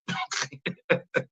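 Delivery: soft, breathy chuckle; quiet laugh trailing off. Real, not acted. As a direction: chuckle softly to yourself. Laughs at how crazy it was.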